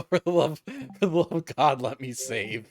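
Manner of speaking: loud, high exasperated plea; exasperated outburst. Real, not acted. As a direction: beg the game in frustration. Exasperated, game won't let her save.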